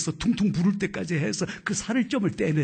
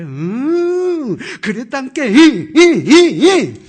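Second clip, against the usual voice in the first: mock stammer; 8 semitones higher, 16 dB louder, much slower than usual. Mimicking the Jeolla teacher.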